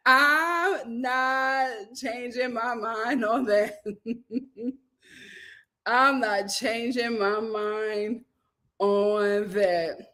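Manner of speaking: slow, deliberate "I'm not changing my mind"; slow insistence. Real, not acted. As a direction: drag each word out, stubbornly. Slow emphatic repeat.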